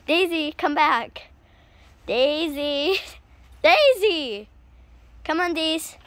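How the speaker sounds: loud call for the dog. Real, not acted. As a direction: call her name across the yard. Calling dog outside.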